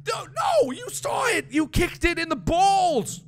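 high, shouted outrage at the game; worked up. Real, not acted. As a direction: yell it in exasperated disbelief. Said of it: "I am getting way too worked up".

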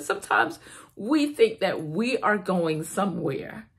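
ordinary talk, nothing marked

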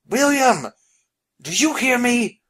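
high, urgent calling out; shout. Acted. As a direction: call out loudly, demanding an answer. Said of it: "Phineas shouted again".

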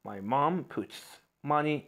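very slow, broken into pieces; very slow dictation. Shown as a demonstration. Teacher reads an example sentence very slowly. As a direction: say it slowly, chunk by chunk. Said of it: "slow example sentence".

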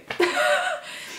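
a squeaky giggle at herself; high embarrassed laugh. Real, not acted. Vlogger laughing at how emotional she got, high giggle.

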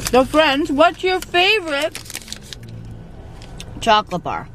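talking with mouth full. Real, not acted. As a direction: speak through a mouthful of chocolate. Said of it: "I'm gonna talk to you with my mouth full".

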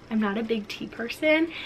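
ordinary talk, nothing marked

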